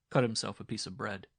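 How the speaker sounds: ordinary delivery, nothing marked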